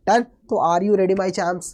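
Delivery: ordinary talk, nothing marked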